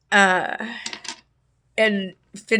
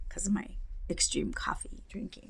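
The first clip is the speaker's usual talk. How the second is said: sheepish whispered confession; hushed admission of coffee habit; 15 dB quieter than usual. Real, not acted. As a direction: whisper the embarrassing part.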